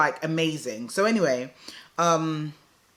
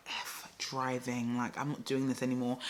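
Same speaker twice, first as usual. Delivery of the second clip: fed-up resignation; 4 semitones lower, 11 dB quieter than usual. Retold or imitated. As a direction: drop the voice, drag it out wearily. Retold giving up on driving.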